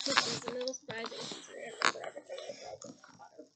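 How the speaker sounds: fast half-whispered aside; quick aside. Real, not acted. Narrator aside, self-deprecating.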